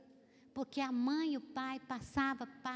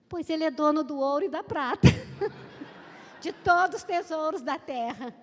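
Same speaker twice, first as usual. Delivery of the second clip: triumphant retort; 3 semitones higher, 7 dB louder than usual. Retold or imitated. Girl's triumphant retort to bully.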